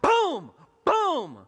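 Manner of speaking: very loud, high shouted impacts; explosive comic exclamation; booming smack noises. Acted. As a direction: shout each hit as a sound effect. Acting out the smacks.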